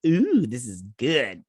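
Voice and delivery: mildly savoring, drawn-out ooh; slow, relishing mock reaction; lightly acted pleased discovery; retold delight. Retells own delighted reaction to scene.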